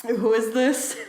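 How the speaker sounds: drowsy, just woken up; sleepy mumble over breakfast. Retold or imitated. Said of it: "sleepily in, like, his, like, pajamas".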